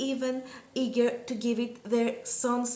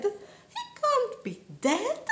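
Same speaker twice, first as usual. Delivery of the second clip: wailing; 11 semitones higher, much slower than usual. Retold or imitated. Second take, higher and slow.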